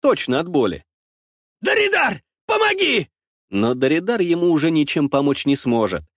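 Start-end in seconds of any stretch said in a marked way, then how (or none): 1.6-2.2 Даридар cried out very high with a falling wail; pained cry for help. Acted. Wounded thug calling his comrade Даридар, desperate cry. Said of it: "орёт он". 2.5-3.1 desperate shout. Acted. Wounded thug begging Даридар for help, desperate shout. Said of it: "орёт он".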